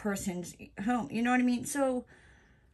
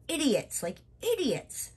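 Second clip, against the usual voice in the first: ranting; 5 semitones higher than usual. Real, not acted. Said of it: "sorry about that little rant there".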